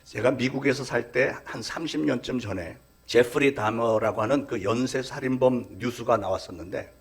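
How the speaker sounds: ordinary delivery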